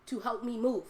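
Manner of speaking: normal talking voice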